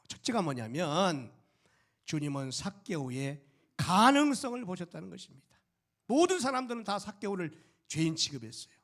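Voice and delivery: loud, high, driving preacher's voice; fervent preaching. Acted. Pastor exhorting the congregation about grace, full-force preaching.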